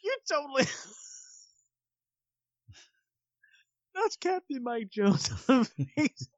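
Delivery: real laughter breaking up high-pitched words; laughing. Real, not acted. Teasing the co-host between bursts of laughter, laughing. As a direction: talk through helpless laughter.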